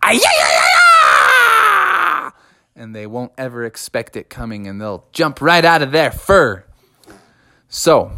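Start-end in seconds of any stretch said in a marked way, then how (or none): 0.0-2.3 high, whooping practice scream; Bubby rehearsing his scare scream; wild banshee scream. Acted. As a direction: let rip a silly banshee howl. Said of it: "my wild banshee scream".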